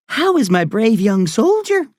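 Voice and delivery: high Irish woman's voice; Irish accent. A narrator's reading. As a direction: greet a young patient in a high, lilting Irish accent. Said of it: "She has an Irish accent".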